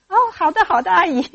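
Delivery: put-on high, loud little-girl agreement; eager child. Shy girl agrees with auntie, high eager. 然后他说.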